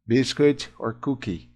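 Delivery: ordinary talk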